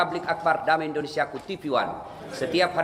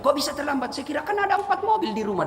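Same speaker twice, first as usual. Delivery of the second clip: high-pitched disbelieving question; acted incredulous maid; 10 semitones higher than usual. Maid doubts master with four cars was late, high incredulity. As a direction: question the excuse, voice pitched right up.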